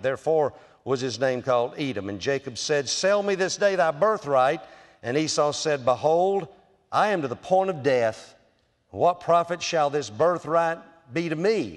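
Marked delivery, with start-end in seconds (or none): 2.9-4.6 higher, louder bargaining demand; lightly voiced Jacob. Jacob bargaining with his hungry brother, a hint of demand. 5.8-11.8 higher, louder pleading; lightly voiced desperate Esau. Esau pleading with Jacob, mildly desperate. Reads Esau's reply.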